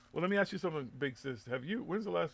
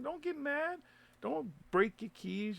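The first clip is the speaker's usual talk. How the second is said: playful high plea; 13 semitones higher, much slower than usual. Real, not acted. Teasingly pleading with the chat.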